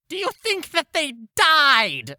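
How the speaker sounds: high, loud squeaky cat voice; cat sentry voice. Acted. Cat sentry asks its partner, high and squeaky. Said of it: "and the one cat goes".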